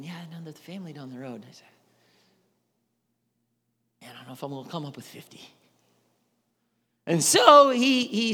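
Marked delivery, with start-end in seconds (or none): 0.0-5.4 much softer, hurried aside to himself; worried under his breath; mock muttering. Abraham fretting to himself, soft worried mutter.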